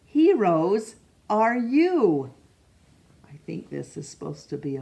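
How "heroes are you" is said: ordinary delivery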